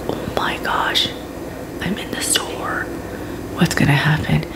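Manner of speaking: hushed, frightened whisper; retold panicked whisper. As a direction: whisper as if scared in a crowded store.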